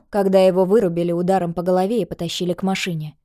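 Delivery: ordinary delivery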